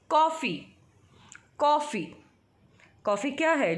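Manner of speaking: teacher dictating कॉफ़ी; clear dictation. Shown as a demonstration. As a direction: call out कॉफ़ी like English coffee. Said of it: "I will be pronouncing these words".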